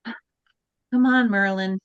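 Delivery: ordinary delivery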